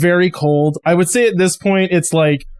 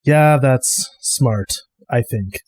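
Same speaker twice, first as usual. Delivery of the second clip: Maul, tired and slow; 5 semitones lower, slower than usual. Acted. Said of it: "sighs, looking off towards him and goes".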